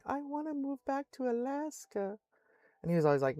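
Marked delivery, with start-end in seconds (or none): 0.0-2.2 high, much softer pleading mom; whining to go home. Retold or imitated. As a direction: mom begging dad to move, plaintive. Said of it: "for years going".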